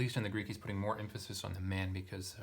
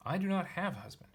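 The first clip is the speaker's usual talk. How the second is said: higher, lightly acted woman's reply; Samaritan woman voiced; 9 semitones higher than usual. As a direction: lift the voice into the woman's line. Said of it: "Woman responded and said".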